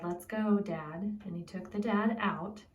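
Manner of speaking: ordinary talk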